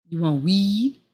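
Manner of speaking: ordinary talk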